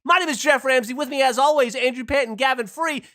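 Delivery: high, bright host introduction; podcast host voice. Acted. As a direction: announce the show and guests.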